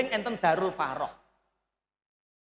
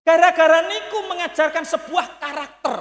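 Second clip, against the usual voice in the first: emphatic preaching; 7 semitones higher, 8 dB louder than usual. Real, not acted. Preacher stressing the lesson.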